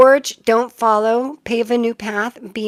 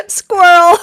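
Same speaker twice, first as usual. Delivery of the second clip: much higher, loud startled yelp; squirrel yelp; 8 semitones higher, 13 dB louder than usual. Real, not acted. Reader spots the squirrel again mid-greeting, high startled yelp. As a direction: yelp it the instant you see it.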